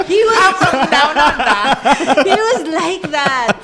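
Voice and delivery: slow words through real laughter; laughing. Real, not acted. Friend laughing while telling about her brother.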